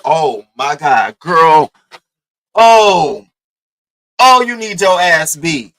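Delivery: loud, drawn-out indignation; outraged reaction. Retold or imitated. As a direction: reenact an appalled outburst, dragging out the words. Retells outraged reaction to the hitting.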